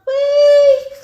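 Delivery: squealed high whee; gleeful high whee; high sung whee. Acted. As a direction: swoop up high like a leap. Frog leaps away.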